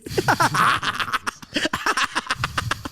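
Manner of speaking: long high wheezing laugh; helpless stuttering laughter; amused laughter. Real, not acted. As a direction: crack up at your own story. Laughs at his own escape.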